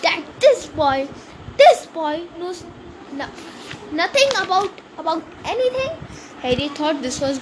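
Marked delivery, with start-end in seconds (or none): 0.1-5.9 halting, high-pitched indignation; growled. A narrator's reading. Hagrid confronts the Dursleys over Harry's ignorance, mildly growling. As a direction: sputter it in pieces, rising in pitch. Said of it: "he growled at the Dursleys".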